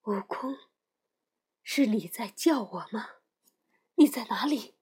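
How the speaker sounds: a faint, whispered plea from a captive; tearful whisper. A narrator's reading. Bound Tang Seng whispers to unseen Wukong, tearfully.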